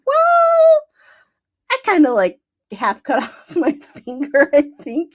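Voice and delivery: high, loud, slow with a laugh; sheepish confession. Retold or imitated. Telling the doctor she cut her finger, sheepish. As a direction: admit it sheepishly.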